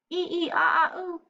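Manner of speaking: high hooting monkey calls; monkey noises. Acted. Reader does her best monkey, high hooting. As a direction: hoot like a chattering monkey. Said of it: "It was my best monkey".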